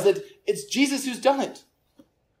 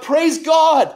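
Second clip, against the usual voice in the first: higher, louder shout of praise; loud cry of praise; 6 semitones higher, 5 dB louder than usual. Retold or imitated. Healed leper cries out to God, loud praise. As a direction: cry it out with a raised voice. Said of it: "With a loud voice, he cried out".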